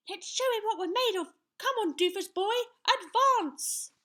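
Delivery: put-on high, loud child hero; little knight. A narrator's reading. Young knight stands up to the giant, defiant. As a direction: dare him in a high boyish voice. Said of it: "said Sir Scallywag".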